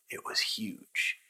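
plain reading voice

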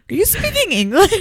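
high-pitched puzzled driver voice; imitated Lyft driver. Baffled Lyft driver questioning the passenger, in a high mimicking voice. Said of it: "one of the Lyft drivers was like".

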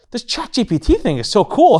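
mock gushing wife; delighted wife. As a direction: gush about the new toy. Wife voiced excitedly.

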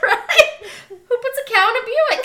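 high, laughing repeat. Real, not acted. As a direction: laugh the question out. Still laughing at the quote.

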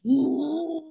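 high-pitched nervous laugh; nervous laughter. Real, not acted. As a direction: let a real nervous laugh slip out. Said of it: "this should be nervous laughter".

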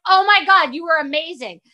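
loud, high mock-amazed praise; fake gushing. Adult praising a kid's concert unseen, loud fake gushing. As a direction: gush far too hard, imitating an awkward adult. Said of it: "imagine as an adult, I'm like".

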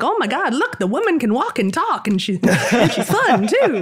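high, amazed voice, laughing at the end; mock astonishment. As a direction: play a man stunned that a woman can talk. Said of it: "he's always so surprised".

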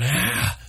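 sharp high cry of shock; startled cry. Acted. Noah hearing a camel talk, high startled cry. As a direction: gasp out as he stumbles back. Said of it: "cried out".

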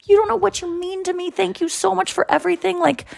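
high, softened, emotional thanks; tearful gushing. Retold or imitated. Fan thanking her idol Jerry, high tearful gushing. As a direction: retell it high and small, nearly tearing up. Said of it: "I started kind of tearing up".

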